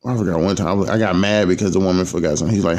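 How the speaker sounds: usual speaking voice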